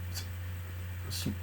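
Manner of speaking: plain reading voice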